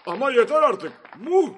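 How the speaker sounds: high, loud outburst; exasperated high-pitched yell; angry. A narrator's reading. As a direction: shout over the crowd, fed up. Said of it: "Başbakan kızmıştı".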